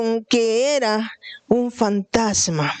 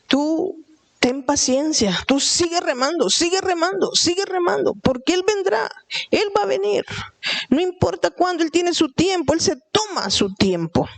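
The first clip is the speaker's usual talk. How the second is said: climbing higher with each sigue remando, pressing the congregation; rising preaching exhortation; 7 semitones higher than usual. Acted. Preacher urging the congregation to keep going, rising fervor. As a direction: build the call to keep rowing, pitch rising line by line.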